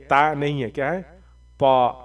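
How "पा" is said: पा with a full, heavy consonant; heavy, emphatic पा; thick पा. Shown as a demonstration. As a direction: thicken the sound of पा. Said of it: "थोड़ा मोटा पढ़ेंगे".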